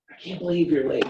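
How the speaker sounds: a mock yell at Abigail for being late; mock scolding. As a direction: pretend to ream her out for being late. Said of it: "I reamed out Abigail".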